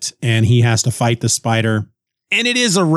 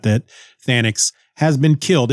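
ordinary talk, nothing marked

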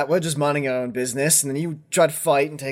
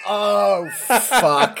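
pained curse; 6 semitones higher, 3 dB louder than usual. Acted. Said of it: "And he's like".

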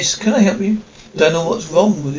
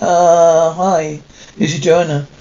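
normal talking voice

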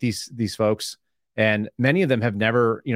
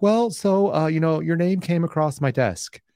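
stiff rookie agent; 6 semitones higher, slower than usual. Retold or imitated. Imitating rookie FBI agent.

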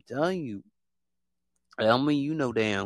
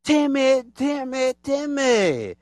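higher, whining envious voice; jealous whiner; 7 semitones higher, slower than usual. Retold or imitated. Mocking jealous imitators, whining high about Timmy. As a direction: whimper like a jealous copycat. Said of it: "They just like".

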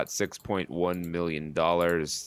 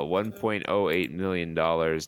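normal talking voice

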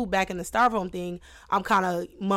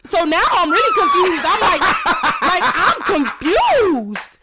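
laughing; 8 semitones higher, 6 dB louder than usual. Real, not acted. Laughing in disbelief.

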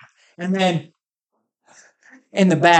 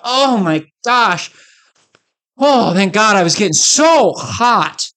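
hammed-up sigh of relief, higher and slow; mock relief; 5 semitones higher, 4 dB louder, slower than usual. Splashing water in front of the cyclists, hammed-up slow relief. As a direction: overdo the refreshed splash. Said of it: "just to mock people".

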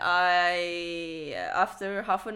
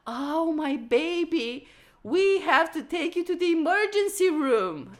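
worried dad; 7 semitones higher, 5 dB louder than usual. Retold or imitated. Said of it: "And my dad was like".

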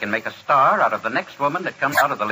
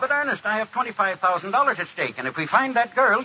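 high-pitched urgent appeal; frantic pleading; 9 semitones higher than usual. Acted. Producer begging his songwriter to stay, frantic. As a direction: plead frantically to keep him from leaving. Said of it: "I was frantic".

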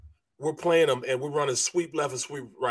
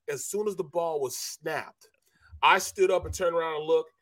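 usual speaking voice

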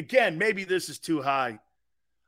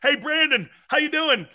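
lightly raised voice of a local caller; South Philly caller; 7 semitones higher, 4 dB louder than usual. Acted. Prank caller praising the host, lightly voiced. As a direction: play the friendly fan calling in. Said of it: "Luigi in South Philly".

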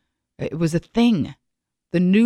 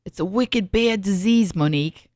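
bad Boston accent; 2 semitones higher than usual. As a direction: attempt your friend's Boston accent, badly. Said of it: "I can't do a Boston accent".

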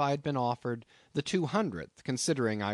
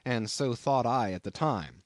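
ordinary delivery, nothing marked

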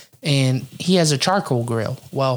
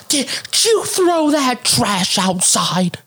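high, slow mock accusation; irate neighbor lady; 9 semitones higher, much slower than usual. Lady accusing him over trash outside, mock outrage. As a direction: mimic an outraged woman, dragging the words out. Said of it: "the lady's like".

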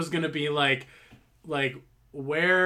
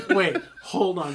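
laughing; 4 semitones higher, 5 dB louder than usual. Real, not acted. Laughing at the accent joke.

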